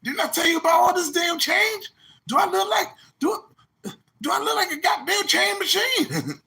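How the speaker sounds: high hammed-up shout; mock furious owner. Club owner yelling at a guest about change, hammed-up shout. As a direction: yell it like an outraged doorman.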